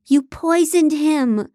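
high, drawn-out accusing cry; screamed accusation. A narrator's reading. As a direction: accuse in a contained narrated scream. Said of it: "a woman's voice screamed at me".

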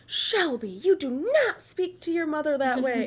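lightly voiced scold; scolding mother-in-law. Carl's mom scolding Shelby for her tone, mock stern. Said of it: "Carl's mom goes".

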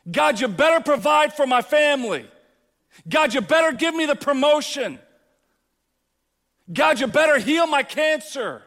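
loud, high, bossy demands; demanding entitled prayer. Retold or imitated. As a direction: order God around. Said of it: "And then we go".

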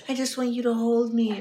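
high, cutesy mock whine; whiny imitation. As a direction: imitate a needy partner begging for a cuddle. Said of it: "Why do you make that sound so whiny".

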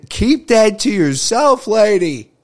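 loud, high, drawn-out exasperation; hammed-up indignant outburst; mock scolding. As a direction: scold her in comic disbelief. Mock scolding the chocolate thief.

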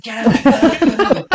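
high, loud shouted "get out"; mock shouting. Retelling being thrown out of the ground, mock high shout. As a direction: yell it like you're being thrown out.

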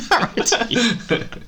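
high, drawn-out echo through laughter; cracking up while repeating the name; laughing. Real, not acted.

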